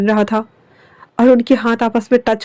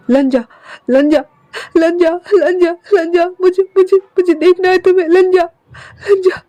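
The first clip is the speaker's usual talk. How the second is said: sobbing calls; 7 semitones higher than usual. Acted. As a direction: call the name while crying. Running, calling lost love.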